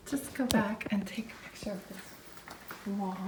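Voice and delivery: whispered aside. Real, not acted. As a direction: whisper it under your breath. Aside while walking off.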